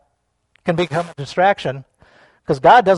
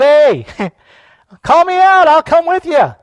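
high, loud volunteering; playful acted yell; eager shout; 10 semitones higher, 8 dB louder than usual. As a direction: holler out an eager offer. Acting eager volunteer calling out.

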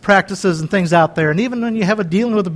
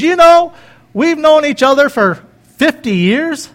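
put-on higher, louder, slow heartfelt line from his old friend; quoting his friend; 7 semitones higher, 4 dB louder than usual. Old friend across the dinner table, higher and slower. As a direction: voice the buddy's big moment, drawn out.

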